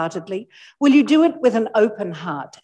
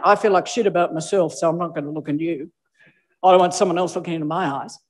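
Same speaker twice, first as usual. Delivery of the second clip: mock insecure voice; 5 semitones lower, faster than usual. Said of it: "you're basically screaming out".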